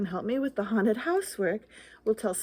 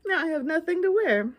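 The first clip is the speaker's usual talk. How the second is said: plain reading voice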